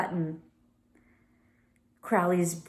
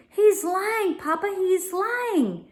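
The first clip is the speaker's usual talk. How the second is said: furious accusation; 6 semitones higher than usual. A narrator's reading. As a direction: round on the liar with fury. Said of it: "eyes gleaming furiously".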